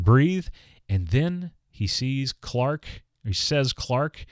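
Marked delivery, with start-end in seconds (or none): 0.0-0.5 higher, louder urgent breathe; urgent call. Retold or imitated. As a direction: push it out like a plea. Doctor reviving Jonathan.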